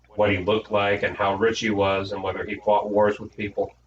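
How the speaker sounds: ordinary delivery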